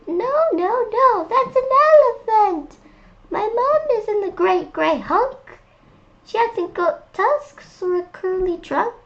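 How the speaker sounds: high monkey voice saying no; little monkey voice. Acted. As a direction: protest like a small stubborn monkey. Monkey rejects elephant.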